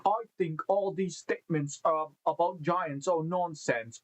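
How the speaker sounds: put-on an Australian accent that slides toward British. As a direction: ham up a playful Australian accent. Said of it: "Should I say that in an Australian accent?".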